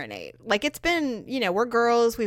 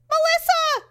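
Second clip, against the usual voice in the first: much higher mock call across a field; 5 dB louder than usual. Parent calling a lost child across the tree farm. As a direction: holler the name to a lost kid. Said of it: "all of a sudden you hear this".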